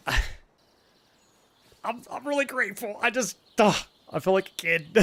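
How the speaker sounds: high voice, close to real tears; overcome with emotion. Real, not acted. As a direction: squeeze it out high, embarrassed and emotional. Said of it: "I am incredibly emotional".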